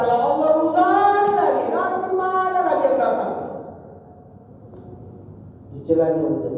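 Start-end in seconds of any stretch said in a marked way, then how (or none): 0.0-3.3 higher, half-sung whining lament; mock complaint. Preacher mimicking a party host cursing the rain, higher mock whine. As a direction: whine like a host whose party got rained on.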